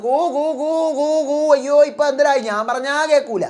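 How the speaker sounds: high mocking coo of a housemate; a housemate calls out from inside; Jindo's voice. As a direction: pitch it up and coo like a bird. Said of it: "Jinto അകത്ത് നിന്ന്".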